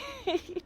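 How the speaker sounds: high-pitched little laugh. Real, not acted. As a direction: giggle high at your own sarcasm. Laughs at own sarcastic remark.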